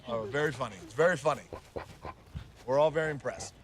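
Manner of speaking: dry sarcastic praise. Acted. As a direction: needle him for the joke. Mocking the prank.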